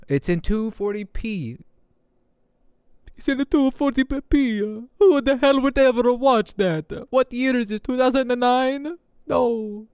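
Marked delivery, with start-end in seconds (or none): 3.2-4.6 high-pitched goofy put-on voice; silly mocking voice. 5.0-9.9 high-pitched goofy put-on voice; mock outrage in a high, silly voice. Keeps silly voice, mocking old video.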